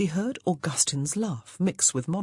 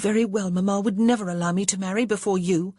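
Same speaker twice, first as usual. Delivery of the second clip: mildly angry, higher-pitched reproach; a touch of indignant anger, pitched up; 4 semitones higher, 3 dB louder than usual. A narrator's reading. Grace reproaching her sister over marriage, mildly angry. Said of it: "Grace said angrily".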